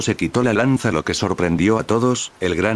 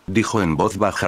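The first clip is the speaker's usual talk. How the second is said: ordinary delivery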